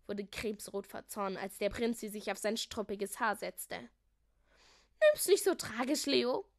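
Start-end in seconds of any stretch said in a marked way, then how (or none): 5.0-6.5 higher, louder mocking voice; teasing consolation. A narrator's reading. Melissa to beaten Leo, loud high teasing. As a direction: tease the sore loser.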